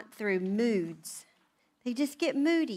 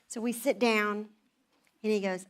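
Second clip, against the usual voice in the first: ordinary delivery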